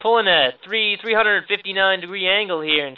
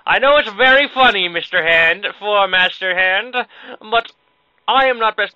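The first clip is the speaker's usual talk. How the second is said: mock indignation; 3 semitones higher, 5 dB louder than usual. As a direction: address the villain with stagy annoyance. Mock scolding the game's Master Hand.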